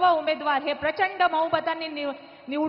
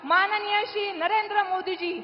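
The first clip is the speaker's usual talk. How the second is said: grand stage introduction; 4 semitones higher than usual. Acted. Stage host introducing the chief guest.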